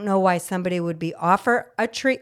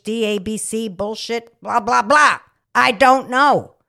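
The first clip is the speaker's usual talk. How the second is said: exasperated outburst; 3 semitones higher, 5 dB louder than usual. Real, not acted. Nurse ranting at the pill pushers, fed up and loud. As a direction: throw up your hands in disgust. Mad rant about pills.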